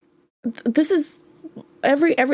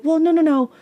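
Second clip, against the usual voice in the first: higher, pleading inner voice; people-pleasing side; 5 semitones higher than usual. Retold or imitated. People-pleasing side excusing a bad partner, pleading. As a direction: plead lightly, pitched up. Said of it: "your people-pleasing side is like".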